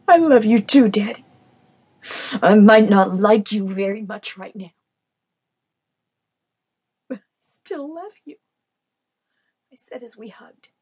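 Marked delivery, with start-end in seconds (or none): 0.1-8.4 tender reply. Acted. Reconciling hug with her father.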